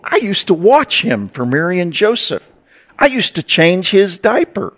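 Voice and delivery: lightly voiced village gossip; gossipy neighbour. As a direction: play the busybody who babysat him.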